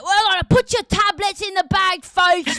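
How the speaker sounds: hammed-up aggressive British accent. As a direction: bark it like a menacing British thug. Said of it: "it's really aggressive, uh, British accent".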